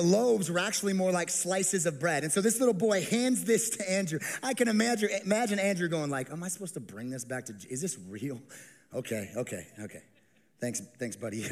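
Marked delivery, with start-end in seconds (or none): 6.3-11.5 lower, softer, bemused mutter; baffled aside. Acted. Andrew unsure with the boy's lunch, soft bemused aside. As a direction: talk it under your breath, puzzled.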